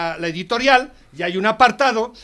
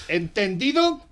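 ordinary talk

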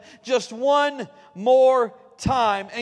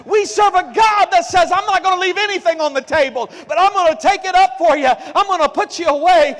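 higher, louder, building sermon climax; full-force preaching; 4 semitones higher, 5 dB louder than usual. Acted. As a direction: preach it out, rising to a shout.

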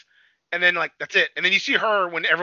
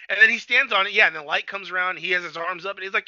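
ordinary talk, nothing marked

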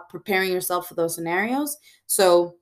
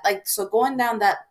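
ordinary talk, nothing marked

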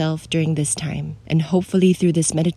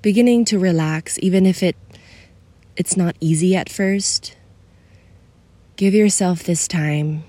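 soothing guide for someone feeling alone; guided meditation. Acted. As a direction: ease the listener into stillness.

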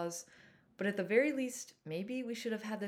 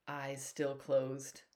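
usual speaking voice

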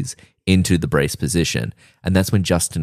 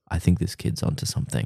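quiet aside; 8 dB quieter than usual. Retold or imitated. Trish grabs arm, speaks quietly.

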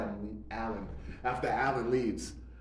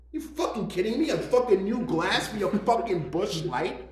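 raised, higher mock tirade at a customer; mock yelling; 8 semitones higher, 4 dB louder than usual. Bartender mock-yelling at a customer over a glass. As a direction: bark it like an angry bartender.

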